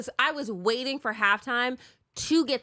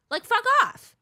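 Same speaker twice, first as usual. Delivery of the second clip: higher and louder, fed up; angry outburst; 12 semitones higher, 6 dB louder than usual. Real, not acted. Venting to her husband about paparazzi, angry. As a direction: spit it out in disgust.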